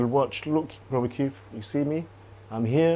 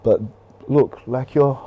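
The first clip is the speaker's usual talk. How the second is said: usual speaking voice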